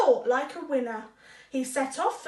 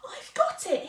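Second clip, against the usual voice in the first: excited mouse; 8 semitones higher than usual. Acted. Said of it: "he said".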